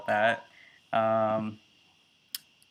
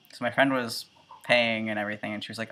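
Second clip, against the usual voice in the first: ordinary talk, nothing marked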